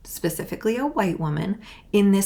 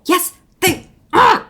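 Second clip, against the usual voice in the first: slow, much higher and louder; furious retort; 10 semitones higher, 9 dB louder, slower than usual. Real, not acted. A creator yelling back at people who told her to calm down. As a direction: spit it back at them.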